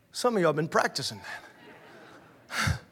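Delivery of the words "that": usual speaking voice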